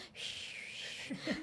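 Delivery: long hushed "shhh" of surf; imitated beach waves. Storyteller giving listeners the sound of the beach, imitated wave whoosh. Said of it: "yung sound ng beach".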